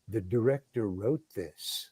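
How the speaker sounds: low, soft and slow; very calm. Retold or imitated. Script girl breaking news to the actor, very calmly. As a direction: deliver the news with quiet, unhurried calm. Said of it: "she says very calmly".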